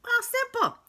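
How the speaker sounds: very high, loud sing-out; exaggeratedly high and chirpy; mock breezy. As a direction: pitch it way up as if it were easy. Mock-easy fix for candida.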